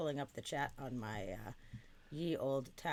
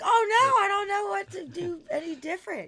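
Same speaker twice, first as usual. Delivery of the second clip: shrill panicked squeal; panicked high voice; 15 dB louder than usual. Retold or imitated. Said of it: "Or like".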